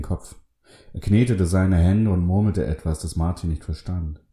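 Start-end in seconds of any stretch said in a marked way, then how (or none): none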